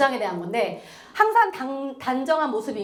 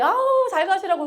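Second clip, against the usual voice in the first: cheery goodbye; 7 semitones higher, 3 dB louder than usual. Retold or imitated. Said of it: "하면서 나 혼자".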